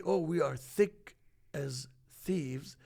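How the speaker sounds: slow, spelled-out delivery of the phrase; slow demo of the idiom. Shown as a demonstration. Explaining the idiom to the host, slow and deliberate. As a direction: say it slowly so the listener gets it. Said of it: "it's an expression when you say".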